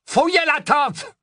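high, slow shouted command; high shouted order from another Death Eater; barked order. A narrator's reading. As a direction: bark the order at the men. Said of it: "dit une autre voix".

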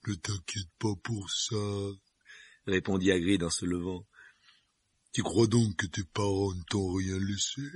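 giant voice. Acted. Hagrid voiced by narrator.

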